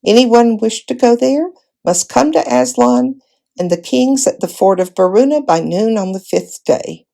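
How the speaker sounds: ordinary delivery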